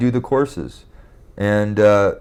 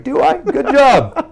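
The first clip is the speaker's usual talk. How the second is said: much higher, mocking sarcasm with a laugh; sarcastic retort; 4 dB louder, slower than usual. Replying to Kathy's remark on his mood, high sarcastic laugh. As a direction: toss it back, dripping with mock cheer.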